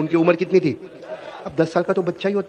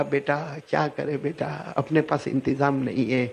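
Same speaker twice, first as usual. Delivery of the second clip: soft, slow, sorrowful; a weeping mother telling her son they cannot afford it; tearful mother; 4 semitones lower, 9 dB quieter, slower than usual. Retold or imitated. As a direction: say it quietly, as if holding back tears. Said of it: "اب ماں بھی روئی".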